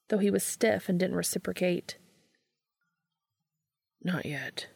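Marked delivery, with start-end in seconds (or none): 4.0-4.7 soft whisper, falling at the end. A narrator's reading. Dean whispering into Cass's ear, hushed. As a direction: whisper it close into his ear. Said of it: "Dean whispered in his ear".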